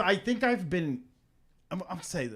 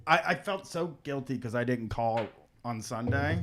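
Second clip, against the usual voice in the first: usual speaking voice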